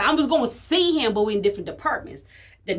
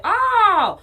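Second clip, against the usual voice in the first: shocked oh; 9 semitones higher, 6 dB louder than usual. Retold or imitated. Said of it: "I was like".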